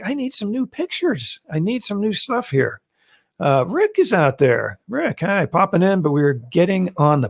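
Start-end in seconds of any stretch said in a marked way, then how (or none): 0.0-2.8 pitched-up mock whine; exasperated. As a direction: whine it out, fed up with old photos. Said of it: "And I'm like".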